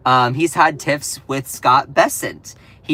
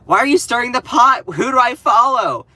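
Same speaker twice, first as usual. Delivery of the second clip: mock whiny shout; 8 semitones higher, 6 dB louder than usual. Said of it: "this person was like".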